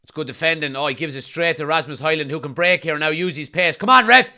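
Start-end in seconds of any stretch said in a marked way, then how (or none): 3.7-4.3 loud appeal to the ref; pleading with the referee. Real, not acted. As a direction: call out to the referee.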